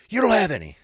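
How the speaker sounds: loud, high mock outrage; hammed-up loud accusation; angry customer. Shopper back from fruitless search, mock outrage.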